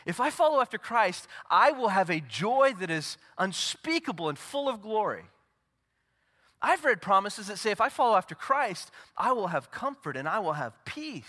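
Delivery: high, loud, ringing pulpit delivery; preaching at full force. Acted. Pastor to congregation, preaching at full force. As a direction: proclaim every promise to the back row. Preacher quoting promises, raised.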